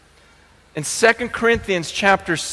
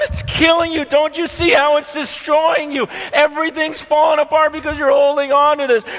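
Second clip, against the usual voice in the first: pleading shout; 8 semitones higher than usual. Retold or imitated. Pastor shouting at church members who cling to sin, high urgent plea. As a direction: cry out to the stubborn congregation. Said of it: "And I'm going".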